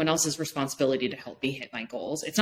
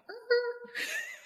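high laugh; 6 dB quieter than usual. Real, not acted. Laugh while explaining.

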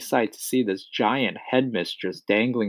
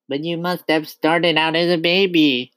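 put-on high-pitched small boy's voice; little boy; 8 semitones higher, 3 dB louder than usual. A narrator's reading. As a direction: argue back as a young pupil. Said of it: "the boy said".

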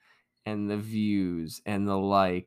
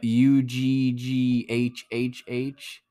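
slow spelling; much slower than usual. Shown as a demonstration. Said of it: "spell it".

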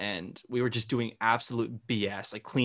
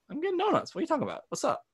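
confused surprise; faster than usual. Retold or imitated. Retold call with mom.

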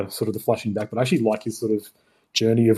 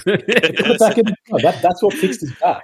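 hamming up the pit scene with a raised voice; mock Bruce in the pit; 8 semitones higher, 3 dB louder than usual.

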